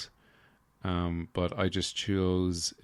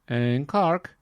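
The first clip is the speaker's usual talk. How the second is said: "In Cork" said higher and louder with a Cork vowel, "Cark"; Cork accent; 7 semitones higher, 6 dB louder than usual. Retold or imitated. Naming the city again in a mock Cork accent, higher and louder.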